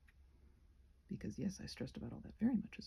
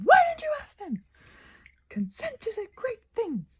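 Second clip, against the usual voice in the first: much higher, louder bossy voice; high mock scolding; 14 semitones higher, 10 dB louder than usual. To her own anxious voice, squealing scolding. Arguing with inner voice.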